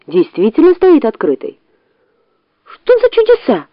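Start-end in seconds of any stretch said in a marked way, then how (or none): 2.6-3.7 very high fox squeal. A narrator's reading. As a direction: squeal in surprise. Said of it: "взвизгнула она".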